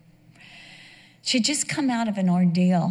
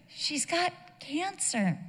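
quiet, slow and soft; 7 semitones higher, 9 dB quieter, slower than usual. Retold or imitated. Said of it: "crying so hard".

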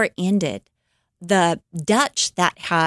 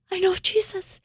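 much higher, excited woman's voice; the Russian woman overjoyed to meet a believer, much higher; 13 semitones higher, faster than usual. Retold or imitated. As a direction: squeal it out with joy.

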